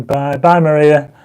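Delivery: ordinary talk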